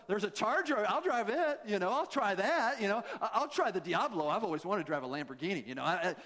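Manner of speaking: ordinary delivery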